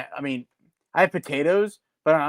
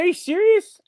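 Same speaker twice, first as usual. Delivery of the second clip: pitched way up in disbelief, are you serious; 3 dB louder than usual. Retold or imitated. Caller to the phone rep, high stunned disbelief. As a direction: squeak it out, stunned and fed up. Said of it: "I'm like".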